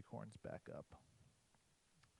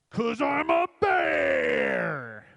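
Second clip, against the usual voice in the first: high, drawn-out bellow; huge slow roar of a bear who is proud of his paws; roaring bear punchline; 11 semitones higher, 16 dB louder, much slower than usual. Acted. Bear's punchline to the joke.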